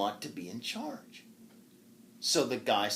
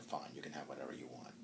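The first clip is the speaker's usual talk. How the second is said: whisper; 9 semitones lower, 17 dB quieter, faster than usual. Acted. Desperate knight agrees.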